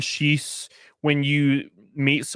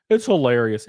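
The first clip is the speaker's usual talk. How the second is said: usual speaking voice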